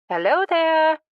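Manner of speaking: calls out loudly from afar; shouting. Acted. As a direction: shout a cheerful greeting. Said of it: "she shouted".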